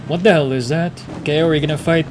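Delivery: ordinary talk, nothing marked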